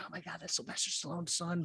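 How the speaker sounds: lightly voiced star-struck woman; mild mock starstruck whisper to friends; starstruck fan. Imitating star-struck vacationer.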